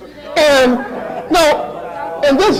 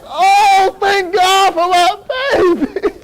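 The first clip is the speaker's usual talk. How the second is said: high, slow, tearful gratitude; mock weeping for joy, words drawn out; happy crying; 7 semitones higher, slower than usual. Mother thanking God for her baby, mock happy sobbing. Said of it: "so happy that she was crying".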